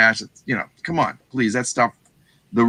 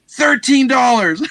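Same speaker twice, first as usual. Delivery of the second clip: quoted price; 10 semitones higher, 4 dB louder, slower than usual. Retold or imitated. Seller names his price.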